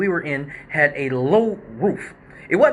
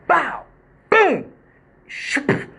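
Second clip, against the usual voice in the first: high, punchy bow and boom, then a breathy shoop; mimicked crash noises; 12 semitones higher, much slower than usual. Retelling the stripper hitting the lights, crash noises. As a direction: sound out the crash like a cartoon.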